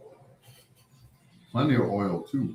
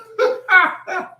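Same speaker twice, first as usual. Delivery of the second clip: high burst of laughter; 8 dB louder than usual. Real, not acted. Laughs at own joke.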